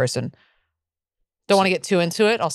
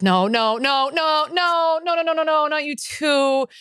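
dismayed protest; 7 semitones higher, slower than usual. Retold or imitated. Retelling protest at dad's conspiracy talk.

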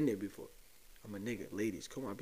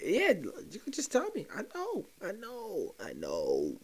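teasing sing-song; 7 semitones higher than usual. Pretend.

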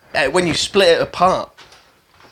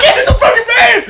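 higher, louder yell breaking into laughter; laughing mock shout; 4 dB louder than usual. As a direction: shout it back, half laughing. Echoing the van shout.

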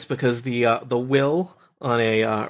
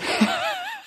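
high giggle. Real, not acted. GM laughs after robot voice.

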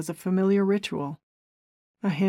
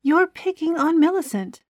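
high, accusing; accusing Gina; 10 semitones higher, 4 dB louder than usual. A narrator's reading. Gina accuses Keith, angry. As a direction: throw the accusation at him.

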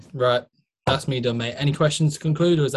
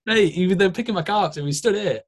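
normal talking voice

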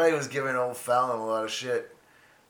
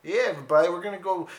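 high mock-Canadian hoser announcement; rally folks in a hammed-up Canadian accent; 5 semitones higher than usual. Hoser addressing everybody in a high Canadian accent. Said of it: "Let out the inner Canadian".

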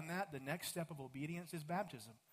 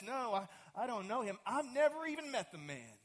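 flustered denial; 6 semitones higher than usual. Acted. Peter's third denial.

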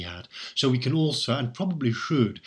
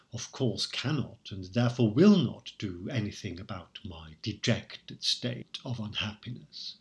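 ordinary talk, nothing marked

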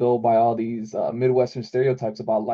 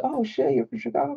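much higher mock gasp of amazement; mock surprise; 12 semitones higher, 4 dB quieter than usual. Strangers hearing he is from Chicago, in high mock amazement. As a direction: act amazed, hammed up a little. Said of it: "And they'll be like".

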